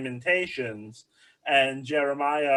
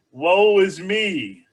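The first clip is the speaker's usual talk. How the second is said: very slow, louder, higher hammed-up lament; drawn-out mock lament, louder and higher; mock wailing God; 5 semitones higher, 7 dB louder, much slower than usual. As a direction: stretch out a theatrical wail as God. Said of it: "God is saying".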